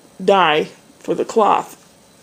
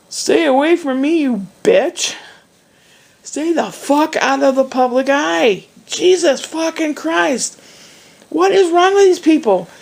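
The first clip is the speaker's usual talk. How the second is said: high, heated swearing rant; angry outburst; 7 semitones higher than usual. Retold or imitated. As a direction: yell it in disgust. Her angry retort retold.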